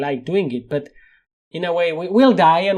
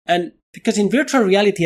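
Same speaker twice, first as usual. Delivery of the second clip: ordinary talk, nothing marked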